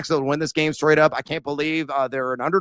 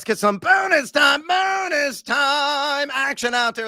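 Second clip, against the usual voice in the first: hyped announcer shout; 9 semitones higher, slower than usual. Acted. Announcing bonus time segment.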